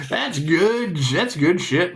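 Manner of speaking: ordinary delivery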